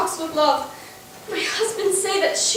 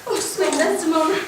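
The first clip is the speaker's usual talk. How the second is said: ordinary delivery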